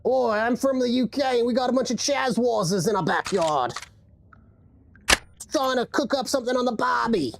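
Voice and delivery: badly faked UK accent, pure mock Australian. Shown as a demonstration. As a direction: attempt British and land in broad Aussie. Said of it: "I can't do a U K accent today".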